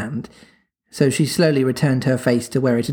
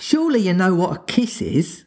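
aghast; 6 semitones higher than usual. A narrator's reading.

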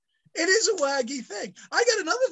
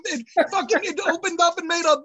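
ordinary talk

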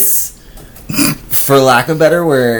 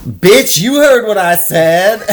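mock threat; 8 semitones higher, slower than usual. Friend firing back at the stranger, mock aggressive. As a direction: snap back like a street brawler. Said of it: "She goes".

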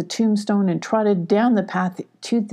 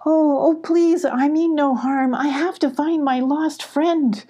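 high-pitched urgent begging; high, beseeching little cat; pleading; 8 semitones higher than usual. Acted. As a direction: plead for mercy, pitch well up. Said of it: "pleaded Molly".

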